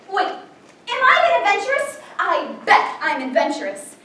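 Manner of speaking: normal talking voice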